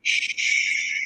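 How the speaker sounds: a breathy whispered "Shh"; hushing. Acted. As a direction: silence them with a hush. Hushing a date in a scene.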